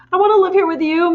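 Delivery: high, loud pleading cry; cried out. A narrator's reading. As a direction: beg her teacher to keep her. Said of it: "Matilda cried out".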